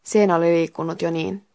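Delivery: ordinary delivery, nothing marked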